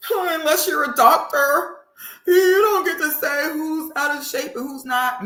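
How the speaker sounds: high, sneering parody of her words; mock her in a high voice; mocking repeat. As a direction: repeat it back like a sneering imitation. Mocking the woman's clip.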